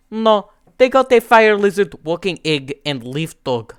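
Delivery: put-on Russian accent starting high and loud. Russia correcting the list of creatures, Russian accent. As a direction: correct them in a Russian accent, easing down at the end. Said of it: "the accent is perfect".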